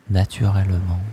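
normal talking voice

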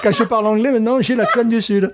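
amused laughing voice. Real, not acted. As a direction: talk while laughing at yourself. Laughing about his accent.

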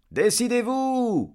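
very high, loud, stretched yell; crowd shout. A narrator's reading. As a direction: holler it as an impatient crowd. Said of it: "crièrent les gens". (cri de foule, aigu et fort)

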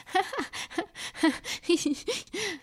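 high, ticklish giggle; acted high-pitched laughter from a tickled flower; giggling. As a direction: giggle in bursts as if being tickled. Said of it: "rigola Fleur de nuit".

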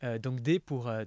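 ordinary talk

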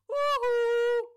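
very high, loud street call; high calling hoot. Shown as a demonstration. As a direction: call out to a friend far off. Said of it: "vous voyez un copain dans la rue".

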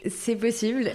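ordinary talk, nothing marked

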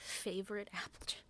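hushed sarcastic echo; breathy mocking whisper of her words. Mocking the wife's line.

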